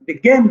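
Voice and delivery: "begin" with a light be and GIN punched high; stress on second syllable. Shown as a demonstration. Teacher giving a verb example, second syllable stressed. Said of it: "stress is on to the second".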